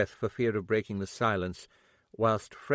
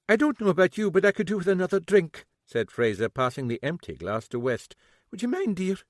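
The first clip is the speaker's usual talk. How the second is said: genteel lady; 8 semitones higher than usual. Acted. Fraser's voice.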